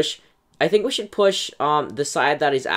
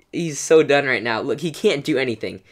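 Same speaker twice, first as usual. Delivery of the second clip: normal talking voice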